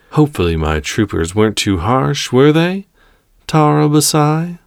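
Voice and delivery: polite menace, lifting high at the end; smooth villain's rising question; calm villain. Acted. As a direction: question the prisoner with mock courtesy. Calm villain addresses his prisoner.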